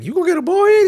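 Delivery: high, loud imitation of a young kid; kid's voice. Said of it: "the kid was like".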